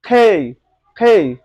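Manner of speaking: "খেই" with aspirated kh, falling; teacher's emphatic breathy-k "খেই"; correct pronunciation. Shown as a demonstration. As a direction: punch "খেই" loud as "khey". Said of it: "এখন থেকে বলবো".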